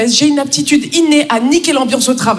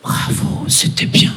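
whispered; 4 dB quieter, slower than usual. Retold or imitated. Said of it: "elle me dit".

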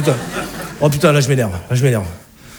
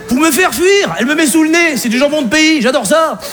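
normal talking voice

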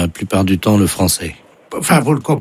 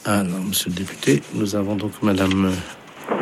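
hushed aside; 8 dB quieter than usual. Acted. Caller aside to fake deputy.

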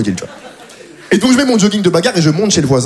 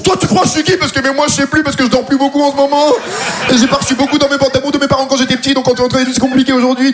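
mock rant; 6 semitones higher than usual. Mock answer to the fight question.

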